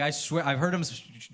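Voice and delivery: ordinary talk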